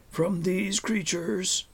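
put-on slow, deliberate old man's voice; an ancient hermit, stern but not unkind; stern deep-voiced old man. A narrator's reading. Old hermit reassures travellers, slow and stern but kind. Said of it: "he said in a deep voice".

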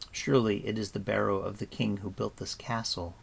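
plain reading voice

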